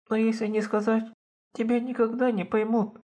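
put-on high, tearful, pleading girl; crying voice. A narrator's reading. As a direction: plead through tears, voice high. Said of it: "заговорила плачущим голосом". (плачущим голосом, высоко и жалобно)

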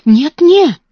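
high, loud eager protest; eager denial. A narrator's reading. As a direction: rush to reassure her friend. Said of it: "воскликнула Эрменгарда". (горячее возражение)

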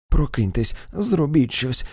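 a mother's desperate cry for help; trembling plea. A narrator's reading. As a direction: beg her to wake up, terrified for the child. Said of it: "тремтячи вона благала".

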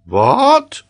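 loud, high outburst; shocked cry. A narrator's reading. Geographer hears the boy's wrong answer, loud shocked cry. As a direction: cry out in sheer disbelief. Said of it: "cried Paganel".

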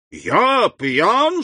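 put-on high, swooping drunk protest; drunken outcry. A narrator's reading. Caderousse accused of being drunk, crying out indignantly. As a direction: cry out, indignant and tipsy. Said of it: "вскричал Кадрус".

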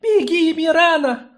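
put-on high, loud thin girl's cry. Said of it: "услыхал он тоненький голос".

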